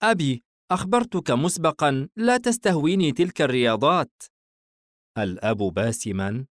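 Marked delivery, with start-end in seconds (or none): 0.0-4.1 put-on high-pitched, mildly annoyed boy; displeased son. A narrator's reading. Son objecting to baseball with his father, mildly displeased. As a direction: protest as the son, pitched well up. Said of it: "الابن باستياء".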